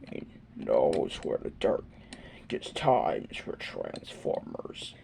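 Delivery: deep, hushed villain voice; low raspy whisper. Acted. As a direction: growl it low and breathy.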